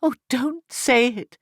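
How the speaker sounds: high, pleading cry of anguish. A narrator's reading. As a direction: cry out in pain, begging him to stop. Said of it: "she cried in her anguish".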